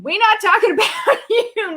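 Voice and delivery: high-pitched, amused reassurance; real laugh mid-sentence. Real, not acted. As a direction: laugh through the words. Laughing reply to a viewer's comment.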